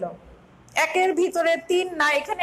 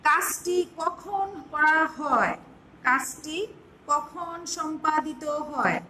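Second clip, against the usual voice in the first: slow, deliberate dictation; slow dictation pace; dictating slowly; much slower than usual. Shown as a demonstration. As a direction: dictate the definition for notes. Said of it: "সংজ্ঞাটি আমি এইভাবে দিতে চাই".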